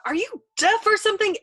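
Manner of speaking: high, loud, frantic question; exasperated yelp. A narrator's reading. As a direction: yell it in desperate exasperation. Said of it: "he yelped beseechingly".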